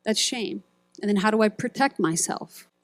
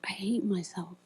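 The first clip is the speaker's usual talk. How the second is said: whispered, much softer; whispered self-hate; 11 dB quieter than usual. Retold or imitated. Speaker voicing a hidden self-hatred, whispered. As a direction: breathe it out as a secret confession. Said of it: "we may even say things like this".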